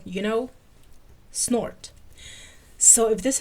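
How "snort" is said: short voiceless nasal snort; just a quick puff of a snort; little snort. Shown as a demonstration. Showing how Krychek laughed, a little snort. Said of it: "a little snort".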